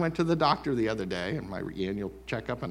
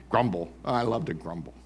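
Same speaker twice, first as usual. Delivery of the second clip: normal talking voice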